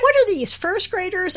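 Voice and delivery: put-on high-pitched sarcastic kid question; mock Dexter lecture. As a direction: tease it like a bored kid. Mocking Dexter's boring talk.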